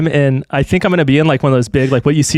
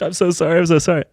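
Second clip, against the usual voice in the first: pleading, pitched up, repeating sorry; whimpering apology, a touch higher; higher apologetic drunk voice; 4 semitones higher, faster than usual. Retold or imitated. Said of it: "the guy's just like".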